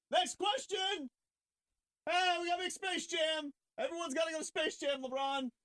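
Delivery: squeaky put-on voice jumping to Space Jam; mock LeBron dodge. Mocking LeBron changing the subject, high and shouty. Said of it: "When LeBron's like".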